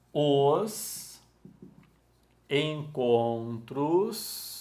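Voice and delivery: very drawn-out syllables with long pauses; syllable-by-syllable classroom dictation; slow dictation. Real, not acted. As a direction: dictate at writing speed, stretching each word. Dictating the rule while writing it.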